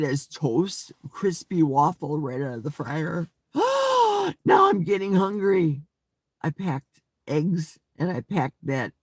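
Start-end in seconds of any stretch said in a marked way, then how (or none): 3.5-4.4 a high, sudden excited gasp; big delighted intake of breath; sharp gasp. Real, not acted. Excited by food talk. 4.4-5.8 louder, lifted cry of sudden hunger; eager shout about getting hungry; excited exclaim. Real, not acted. Excited by food talk.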